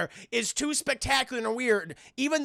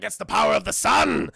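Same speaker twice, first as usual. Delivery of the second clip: loud, mock-heroic declaration; booming boast; 5 dB louder than usual. Retelling Blade boasting over burning Morbius, booming. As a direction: proclaim it loudly like a hero. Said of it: "It's like".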